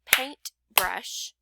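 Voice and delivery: "paintbrush" split into paint and brush; two separate beats on "paintbrush"; clapped-out syllables. Shown as a demonstration. Teacher breaking up the word for pupils, "paintbrush" in two spaced beats. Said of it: "If I clapped out".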